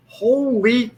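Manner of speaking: high, loud, disbelieving exclamation; retold amazed outburst. Cop recognized on the street by a fan, high loud astonished outburst. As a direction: blurt it out like a stunned stranger just recognized you. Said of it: "And I went".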